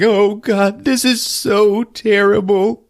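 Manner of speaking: put-on high, slow old-lady lament; mock distraught old lady. Old lady lamenting the murder, mock distress. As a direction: draw it out high in hammed-up dismay. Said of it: "The old lady's like".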